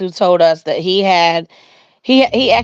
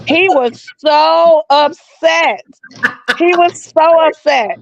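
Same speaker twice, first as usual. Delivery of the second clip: emphatic disbelief; 8 semitones higher, much slower than usual. Retold or imitated. Retelling a man's shocked reaction.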